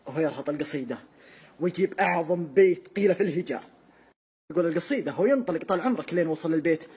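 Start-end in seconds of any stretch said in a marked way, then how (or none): none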